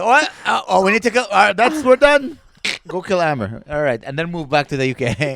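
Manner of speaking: loud, high, hammy frantic rant; excited exclaiming. Retold or imitated. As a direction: blurt it out in a rush. Acted Claire ordering a hit.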